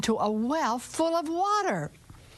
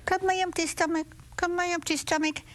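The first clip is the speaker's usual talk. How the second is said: high call; 6 semitones higher than usual. Acted. Rooster summons stomach.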